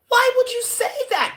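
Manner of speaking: very high, loud wounded protest; indignant disbelief. Retold or imitated. Judas denying to Jesus, high wounded disbelief.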